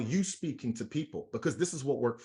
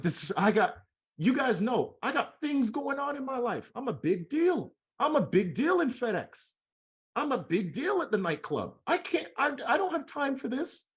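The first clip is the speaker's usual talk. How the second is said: mock self-important; 8 semitones higher than usual. Retold boastful brush-off.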